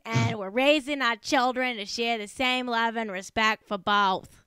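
put-on slowing, broad Boston accent; mock Boston accent. Host finishes the celebrity's statement, mock Boston accent. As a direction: drag out the Bostonian vowels, drop the r's. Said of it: "do the whole thing again in a Boston accent".

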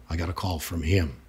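ordinary delivery

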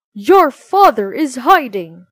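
loud accusing shout; angry shout. Acted. Soldiers accusing the children, loud angry shout. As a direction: bark it at the family like soldiers hunting a man. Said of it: "they shouted".

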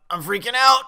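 high, loud outburst of panic; panicked shout. Real, not acted. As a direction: burst out yelling as nerves take over. Repeats panic, second take yelled.

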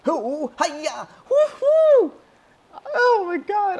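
high, loud victory cries; elated whooping. Real, not acted. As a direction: whoop wildly over the kill. Celebrating a kill.

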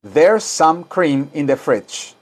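slow, careful English; slow teaching read; slow model sentence. Shown as a demonstration. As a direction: say it slowly for students to repeat. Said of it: "Ahora digan".

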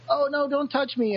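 mock frantic begging to be left alone; high, pained retold cry; panicked plea. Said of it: "it's like".

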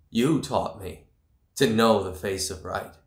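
ordinary delivery

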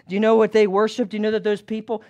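normal talking voice

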